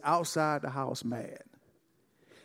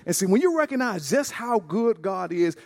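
normal talking voice

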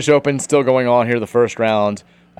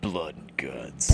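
slow breathy whisper; 8 semitones lower, 9 dB quieter, much slower than usual. Shown as a demonstration. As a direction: hush it like a horror title. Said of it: "You gotta say it right".

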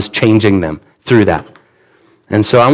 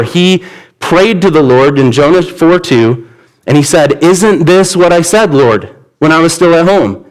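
scripture reading; 5 semitones higher than usual. Acted. Reads scripture aloud.

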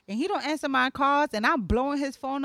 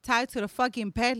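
usual speaking voice

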